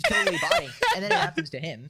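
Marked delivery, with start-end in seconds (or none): 0.0-0.7 very high, loud mocking voice; mock the accuser in a high squeal; high, loud sarcastic mock voice; mocking quote. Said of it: "Oh, this".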